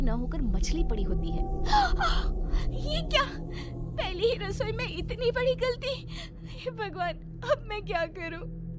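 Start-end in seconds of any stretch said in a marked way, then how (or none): 1.4-2.7 high, loud gasp of horror; shocked gasp. Acted. Shocked at fish in the pan. 2.7-8.6 very high, panicky cry; frightened. Acted. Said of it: "प्रिया बहुत डर जाती है".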